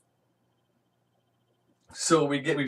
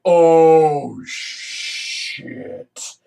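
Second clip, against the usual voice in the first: loud gasp then high breathless oh shit; hammed-up dismayed curse; dread; 5 semitones higher, 8 dB louder than usual. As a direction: react in sudden horror. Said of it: "I'm like".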